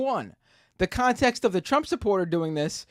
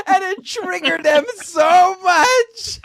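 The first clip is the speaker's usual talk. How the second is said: laughing; 9 semitones higher, 4 dB louder, slower than usual. Real, not acted. Laughing while retelling the clip.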